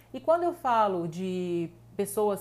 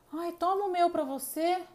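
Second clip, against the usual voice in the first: mock gushing giver; 9 semitones higher, 3 dB louder than usual. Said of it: "Aí a pessoa já".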